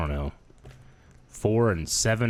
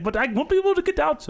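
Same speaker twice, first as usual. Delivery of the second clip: much higher, worried driver voice; hint of a fretting trucker; imitating the driver. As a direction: squeak out the driver's worry.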